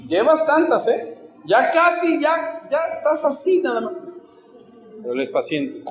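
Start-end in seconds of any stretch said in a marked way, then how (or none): 0.1-3.9 high, mock-threatening warning; advertencia impaciente fingida. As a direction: act out patience nearly used up, pitched well up. Dios imaginado perdiendo la paciencia. (amenaza fingida con voz aguda)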